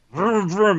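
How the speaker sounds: high vocal imitation of an engine; chainsaw revving noise. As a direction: rev it like a chainsaw.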